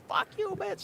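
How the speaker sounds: high pitched fuck you; mock high curse. Passenger cursing driver, high mock. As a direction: spit it back high. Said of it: "I was like".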